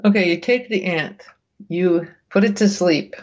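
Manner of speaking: ordinary talk, nothing marked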